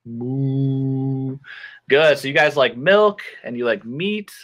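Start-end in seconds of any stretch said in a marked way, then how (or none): 0.1-1.4 long level moo; cow moo. Shown as a demonstration. Teacher showing pupils a cow, drawn-out moo. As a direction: imitate a cow, holding the note.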